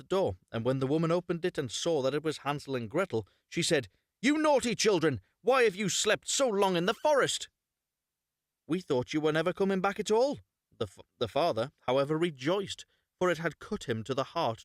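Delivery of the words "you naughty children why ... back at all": much higher and louder shrill-free scold; stepmother scolding. Acted. Stepmother scolding the children who found their way home. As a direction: scold them as if they strayed.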